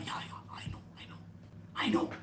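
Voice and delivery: hushed, whispered knowing agreement; conspiratorial whisper. Acted. Guru secretly agreeing with each tattling disciple, whispered.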